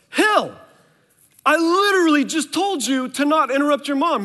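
put-on yelling across the room at a kid who walked off; retold angry shout. Dad in the kitchen scolding his son for leaving, shouting.

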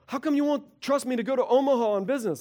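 mock a defensive spouse demanding trust; whiny offender. Offending spouse complains about not being trusted, whining. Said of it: "they're like".

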